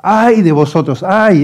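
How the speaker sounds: loud, high cry of woe, drawn out; acted tragic outcry; anguished lament. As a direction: wail it as a grieving warning, preached not sobbed. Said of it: "Es que da ganas de llorar".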